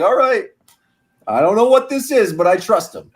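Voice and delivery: high-pitched, imitated go-along reaction; puzzled acceptance. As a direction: play someone baffled but willing to go along, pitched well up. Voicing players' puzzled but trusting reaction.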